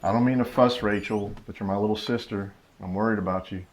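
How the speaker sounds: tender and hushed; gentle concern. Acted. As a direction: ease down into quiet worry. Sister gently voices her worry.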